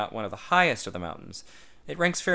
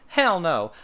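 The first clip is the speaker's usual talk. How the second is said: indignant outrage; 9 semitones higher, 8 dB louder than usual. A narrator's reading. Tad outraged at the idea.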